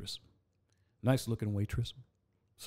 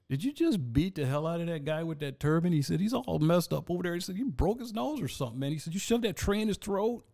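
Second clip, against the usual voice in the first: imitating the manager; 8 semitones higher than usual. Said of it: "So he goes".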